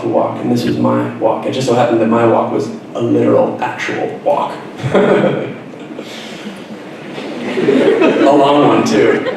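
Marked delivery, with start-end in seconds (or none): 4.6-7.5 soft laugh. Real, not acted. Laughing at own joke.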